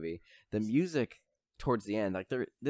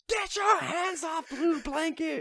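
much higher, slow shrieking yell; frantic shout; 4 dB louder, slower than usual. Retold or imitated. Retelling the blanket scene, high slow shrieking yell. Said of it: "he loses".

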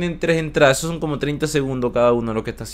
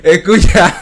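laughing; 11 semitones higher, 7 dB louder, slower than usual. Real, not acted. Laughing at verse reaction.

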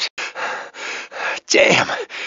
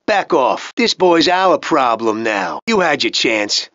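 ordinary delivery

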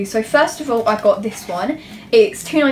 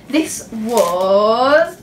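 drum-roll suspense; 4 dB louder than usual. Real, not acted. Vlogger revealing a folder's price, in drawn-out suspense. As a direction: stretch out "was" with a rising tune. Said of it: "drum roll".

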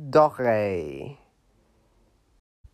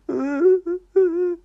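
high puppet laugh; 5 dB louder than usual. Acted. Puppet reacts to refusal.

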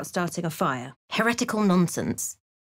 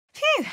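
relieved phew. Acted. Relief exclamation.

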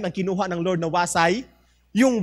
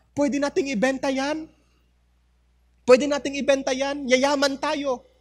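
greedy wife; 7 semitones higher than usual. Acted.

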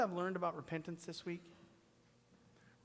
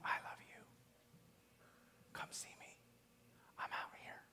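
softer, hushed voice of God calling; whispering God; 8 dB quieter than usual. Acted. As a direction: whisper as God inviting the listener. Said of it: "God's saying".

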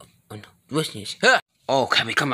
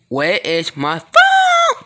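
slow, louder, higher drawn-out question; exasperated; 6 semitones higher, 7 dB louder, much slower than usual. Acted. Kid searching for the phone, slow and exasperated. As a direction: stretch it out in frustration.

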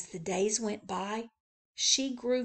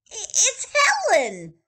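put-on high, louder excited boy; 14 semitones higher, 8 dB louder than usual. Billy recognizing Helen, higher and louder. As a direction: stumble in excitement. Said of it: "Billy shouted".

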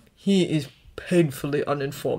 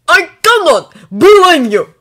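slow, high, loud outburst; panicked, upset; 9 semitones higher, 12 dB louder, slower than usual. A narrator's reading. Dragon to the boy he pulled from the sea, panicked and upset. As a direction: cry it out in upset, words stretched. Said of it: "like he's panicked and upset".

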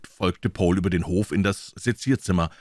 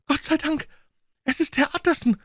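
put-on high old woman's voice, much higher; relieved old cook greeting the lawyer, high cry; old cook crying out in relief. A narrator's reading. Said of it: "rief die alte Köchin".

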